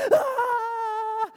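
very high, sustained mock scream; long comic shriek as if being electrocuted. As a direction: imitate a man screaming from electric shock. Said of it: "And he's electrocuted".

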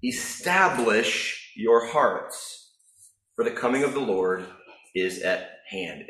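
a hint of solemn scripture reading; scripture quote. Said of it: "James says".

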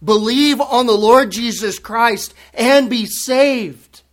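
slow, loud, forceful; urgent plea. Pretend. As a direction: shake them into believing. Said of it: "grab them by their togas and shake them".

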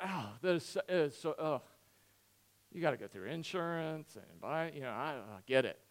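soft, low, halting commiseration; hushed been-there-myself sympathy; acted empathy. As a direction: groan quietly in shared misery. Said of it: "Empathy is if you wreck your car".

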